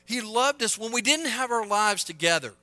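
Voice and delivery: loud, high-pitched preacher's proclamation; forceful preaching. Real, not acted.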